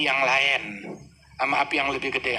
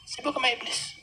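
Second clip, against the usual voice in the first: mocking sneer; 9 semitones higher, 6 dB quieter than usual. Mocking those busy with Iblis.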